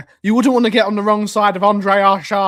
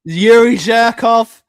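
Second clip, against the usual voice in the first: relishing the name; 3 semitones higher, 6 dB louder, slower than usual. Shown as a demonstration. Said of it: "That's a good name".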